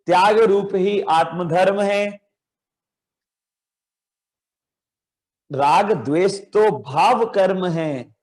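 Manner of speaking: slow, drawn-out chanted recitation of a poem line. Shown as a demonstration. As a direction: recite it slowly for children to echo. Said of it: "चलो फिर से". (धीमा, खिंचा हुआ पाठ)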